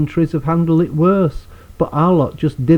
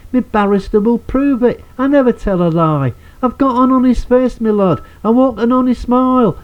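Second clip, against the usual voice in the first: higher, brighter London geezer voice; London accent; 7 semitones higher than usual. Acted. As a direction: play it as a cheeky London lad pleading innocence. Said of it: "I like to do this in a London accent".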